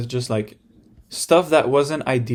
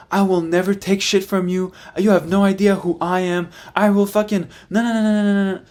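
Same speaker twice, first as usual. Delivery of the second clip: imitating sister, high-pitched and theatrical; sister's dramatic tough talk; 6 semitones higher than usual. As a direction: play the sister acting like a movie tough girl. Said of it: "she was like".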